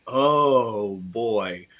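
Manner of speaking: low, drawn-out mock dismay; dismayed reaction. Two characters react to the fall.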